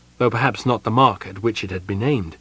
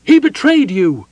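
loud, high furious command; cried out; 12 semitones higher, 6 dB louder than usual. Acted. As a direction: urge them to kill, loudly. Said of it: "Mina cried".

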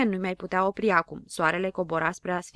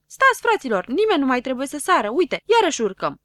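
very high, loud call to stop; urgent shout; 6 semitones higher, 7 dB louder than usual. A narrator's reading. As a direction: yell to halt the jumping. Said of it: "și strigă".

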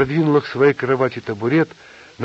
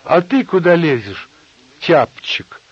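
loud, separated words, challenging; 6 semitones higher, 6 dB louder, slower than usual. A narrator's reading. Nina challenging Alexei, word by word. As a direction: set each word apart, forcefully. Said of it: "раздельно по слову сказала Нина".

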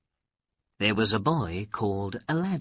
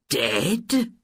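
shocked; 5 semitones higher, 4 dB louder than usual. A narrator's reading. Old man shocked at the news.

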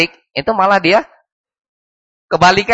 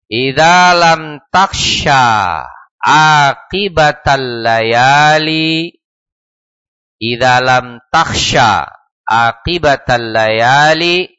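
very slow, drawn-out long vowels; Arabic verse recitation; much slower than usual. A narrator's reading. Teacher quoting a poet to students, slow measured recitation. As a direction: recite the poem deliberately, stretching the syllables.

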